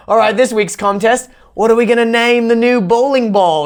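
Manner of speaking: high mock Aussie presenter; imitating Australian hosts. Channel hosts launching the comment contest, high mock Australian accent. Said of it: "where they're like".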